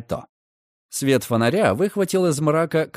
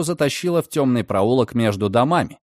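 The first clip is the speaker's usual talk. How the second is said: plain reading voice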